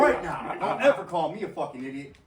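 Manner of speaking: loud, high retold warning; angry challenge. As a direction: re-enact a heated warning, half laughing. Acting out his quarrel with coworker.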